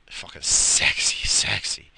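slow, breathy unvoiced "sexy"; sultry whisper. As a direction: whisper it like a come-on, drawn out.

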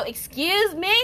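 sassy excuse me. Retold or imitated. Retold confrontation, mocking voice.

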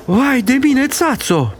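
high, breathless alarm; panicked wail. Acted. Lady fearing the boy will faint inside, panicked wail. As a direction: cry out high in a flurry of panic.

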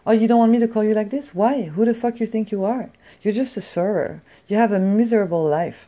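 imitating a snobby girl lashing out at her server; a hint of an angry yelling customer; rude customer yelling. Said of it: "So she's like".

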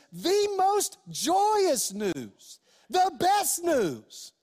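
high, slow, each word weighted; preacher lingering on "joyous" and "best"; slow emphasis. Real, not acted. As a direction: savor "the most joyous news" word by word. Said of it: "Not just good news".

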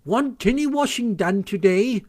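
high, deferential washerwoman query; very respectful. A narrator's reading. Mole as washerwoman asks the sentries, respectful. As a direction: offer your services politely, pitched high. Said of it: "very respectful".

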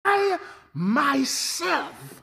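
"I myself" very slow, every word leaned on; emphatic stress. Acted. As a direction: drag out "I myself" and lean hard on "I". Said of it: "the Greek is ego eimi".

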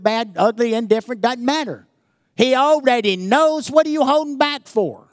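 loud, high-pitched preacher exhortation; forceful preaching. Real, not acted. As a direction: drive it home to the congregation, pitched high.

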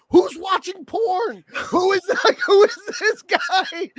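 slow, high laughing shout; bewildered shouting. Retold or imitated. A viewer confused by the intro, shouting with laughter. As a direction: yell in baffled laughter. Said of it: "this intro going".

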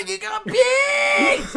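high, slow, thrilled exclaim; hammed-up gushing about the beads; mock stranger gushing over car beads; excited guy. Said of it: "He was so fucking excited".

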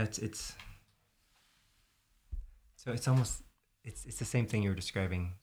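normal talking voice

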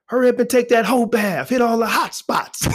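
high mock uncle bark; high teasing uncle voice; loud uncle. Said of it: "He say, Hey".

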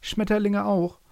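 high, airy butterfly voice; butterflies chiming in. A narrator's reading. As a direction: flutter it up high. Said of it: "ergänzten die Schmetterlinge".

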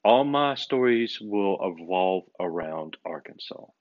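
ordinary talk

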